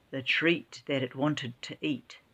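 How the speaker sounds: plain reading voice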